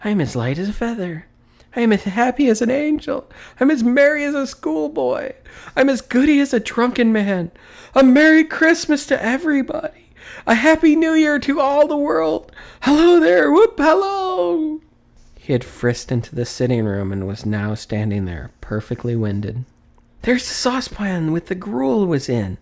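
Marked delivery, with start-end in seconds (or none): 0.0-14.8 very high, loud, racing delight; giddy joy. A narrator's reading. Scrooge shouting Merry Christmas to the whole world, giddy. 20.2-22.6 high, bright excited pointing; eager cry. A narrator's reading. Scrooge frisking round the room, excitedly crying out. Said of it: "cried Scrooge".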